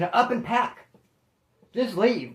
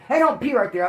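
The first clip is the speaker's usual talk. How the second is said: raised voice, telling a dog off; sharp call-out; 5 semitones higher, 5 dB louder than usual. Real, not acted. Owner telling his dog off, raised and sharp. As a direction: snap at the dog to stop peeing.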